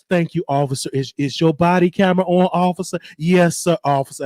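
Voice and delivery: mock-timid, proper-spoken plea to an officer; Karen act. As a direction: play a meek, apologetic Karen lightly. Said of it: "I put my Karen on".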